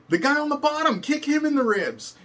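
put-on high, strained teen voice pointing out the target; exasperated teen boy. Teen ringleader correcting the little kid, high and urgent.

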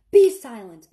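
loud commanding rebuke; stern rebuke. Acted. Jesus rebuking the demons, loud stern command. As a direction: bark it as a command. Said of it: "Jesus rebuked them".

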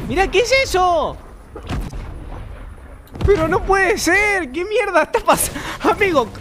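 stretched-out shocked outcry; shocked shouting. Real, not acted. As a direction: yell in real disbelief. Surprised by something in game.

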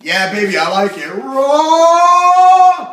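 high, loud, slow stretched yell; hammed-up shout. Topping his own joke for the wife, loud high drawn-out mock yell. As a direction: belt out raw like a rock star.

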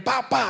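put-on high, loud kid gun noises; mock gunshots. Kids playing guns, shouting high mock gunfire. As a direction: pop off imaginary shots.

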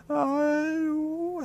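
high, timid yes; scared reply. Acted. Heimdall frightened near the wolf, timid high yes. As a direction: answer small and high, scared. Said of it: "Heimdall är jätterädd".

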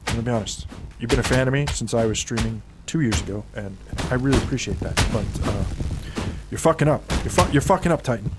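low, soft, hurried aside; hushed, low-pitched pep talk; mock confidential murmur. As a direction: murmur privately as if into an ear. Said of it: "I'm gonna whisper in your ear".

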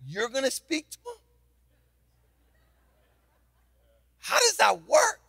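high-pitched, incredulous question; squeaky puzzled disbelief; baffled questioning. Retold or imitated. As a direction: ask it in bewildered disbelief, pitch shooting up. Acting his baffled question to God.

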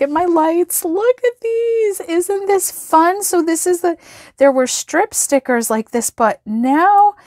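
high-pitched, giddy gushing; thrilled squeal settling as it goes; beyond excited. Real, not acted. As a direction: bubble over with excitement, voice lifted high. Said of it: "I'm beyond excited".

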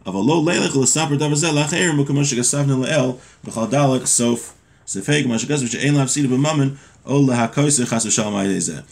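normal talking voice

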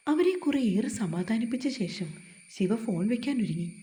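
usual speaking voice

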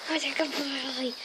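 normal talking voice

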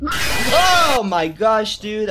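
high, drawn-out yelp of shock; startled cry. Real, not acted. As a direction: cry out high as the scare hits. Startled by game scare.

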